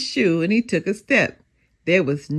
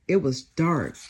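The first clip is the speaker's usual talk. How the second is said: usual speaking voice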